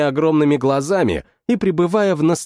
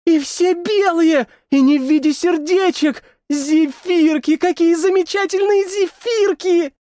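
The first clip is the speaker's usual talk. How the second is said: excited squeaky voice; 13 semitones higher than usual. Acted. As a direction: coo over the sweets ecstatically. Creature gushing over marshmallows.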